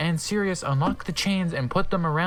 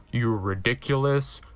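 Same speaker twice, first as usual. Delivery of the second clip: low Snape voice; 7 semitones lower than usual. Acted. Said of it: "Snoop yielded".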